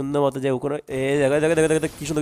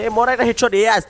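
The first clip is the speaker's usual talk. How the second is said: excited cheer; 7 semitones higher, 6 dB louder than usual. Real, not acted. Headshot kill celebration.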